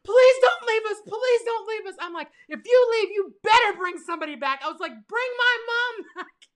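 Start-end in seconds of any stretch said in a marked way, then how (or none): 0.0-1.0 much higher, louder pleading scream; retold scream. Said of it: "Mariah's screaming". 1.1-2.0 high pleading cry. Retold or imitated. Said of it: "Mariah's screaming". 2.5-4.6 high, loud yell after a departing driver; retold yelling. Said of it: "I'm over here yelling". 5.1-6.5 retold yelling. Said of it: "I'm over here yelling".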